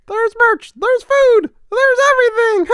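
high squeaky cartoon mouse; Mickey Mouse voice. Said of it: "my Mickey impression".

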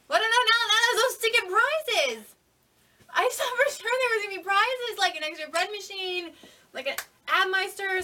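high, loud whiny complaint; sarcastic whine. Acted. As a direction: whine like a spoiled child. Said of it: "This is sarcasm and comedy".

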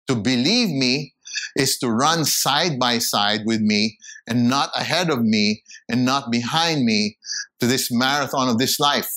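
usual speaking voice

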